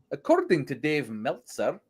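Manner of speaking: soft, lightly mocked Irish accent; a hint of an Irish accent, mocking. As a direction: quote the presenter in a teasing Irish lilt. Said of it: "Andy H Murray sounds like".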